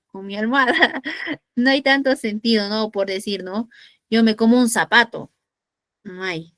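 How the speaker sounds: ordinary delivery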